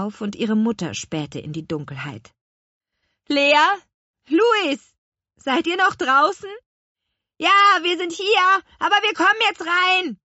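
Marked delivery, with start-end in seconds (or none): 3.3-6.5 high, searching motherly call. Acted. Mother calls children from door. 7.4-10.2 put-on high, loud child's shout; calling back. A narrator's reading. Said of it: "rief Louis".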